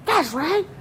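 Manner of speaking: put-on high-pitched, indignant kid rant; mock outburst, pitched right up. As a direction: imitate an aggrieved schoolkid going off. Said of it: "what the Jimmies of this world will do is go".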